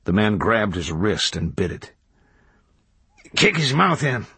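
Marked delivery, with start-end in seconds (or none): 3.3-4.2 high, urgent order in a fight; egging someone on, high-pitched; called order. A narrator's reading. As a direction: call out a brutal command, pitch raised. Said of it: "called Toadvine".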